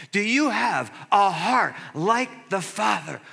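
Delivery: loud, high-pitched preaching climb; fervent preaching. Real, not acted. Pastor urging the church to witness, preaching hard. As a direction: drive the point home to the congregation.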